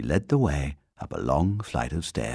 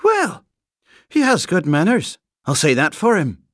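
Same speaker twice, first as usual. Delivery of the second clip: high, loud, grudging approval; doubtful; 13 semitones higher, 8 dB louder than usual. A narrator's reading. Housekeeper granting the bear good manners, doubtful. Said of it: "said Missus Bird doubtfully".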